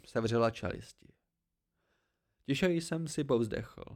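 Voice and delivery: plain reading voice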